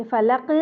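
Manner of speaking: फलक with a flat final qaf, no bounce; wrong pronunciation. Shown as a demonstration. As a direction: say फलक carelessly, no echo. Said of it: "ऐसे नहीं".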